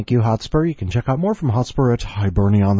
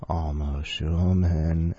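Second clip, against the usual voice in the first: slow savoring; 3 semitones lower, much slower than usual. Pretend. Savoring name slowly.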